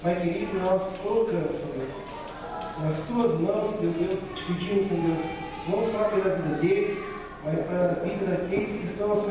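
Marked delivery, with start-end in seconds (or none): none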